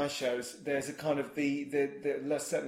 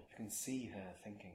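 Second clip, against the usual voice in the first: quiet quote; 6 semitones lower, 16 dB quieter than usual. Shown as a demonstration. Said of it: "Is that how they say it and all?".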